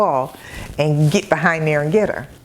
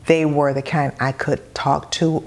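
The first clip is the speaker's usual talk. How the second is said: normal talking voice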